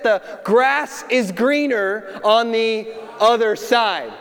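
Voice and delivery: slow, deliberate, like a well-known proverb; the familiar saying. Shown as a demonstration. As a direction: draw out "the grass is greener on the other side". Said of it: "We always say it like this".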